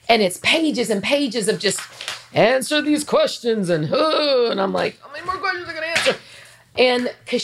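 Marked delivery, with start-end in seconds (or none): 2.3-6.2 high, strained mock complaint; mock exasperation. Woman recalling pages of questions, mock exasperation. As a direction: playfully act worn out, rushing higher at the end. Acting out tedium of long questionnaire.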